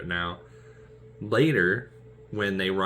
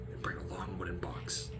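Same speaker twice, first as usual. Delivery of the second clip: whispered menace; whispered threat; 13 dB quieter than usual. Retold or imitated. D'Artagnan threatening Porthos, whispered.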